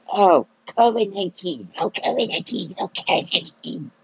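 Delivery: high, sing-song mockery of the news; mocking news voice. Ranting vlogger mocking the news repeating covid nineteen. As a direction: parrot the headline in a silly high voice.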